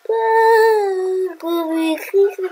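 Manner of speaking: long, high drawn-out whine; high, stretched-out complaint; mock wail. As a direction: wail slowly over a little scratch. Whines about scratching himself.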